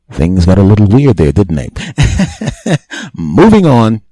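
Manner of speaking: laugh, then push on loud. Real, not acted. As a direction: comedian laughing at his own joke, then moving on. Laughing at own joke.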